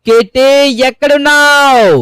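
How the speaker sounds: high, loud, slow drawn-out call. Real, not acted. Calling the kitten from the hilltop, slow high loud shout. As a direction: yell it long so it carries far. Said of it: "ఇక్కడి నుంచి ఒకసారి అరుద్దాం".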